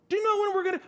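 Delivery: high, rushed mock questioner; pestering church member. Church people come up to the pastor, pestering. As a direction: rattle it off high like an eager parishioner.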